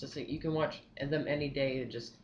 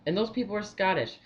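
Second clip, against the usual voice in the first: ordinary talk, nothing marked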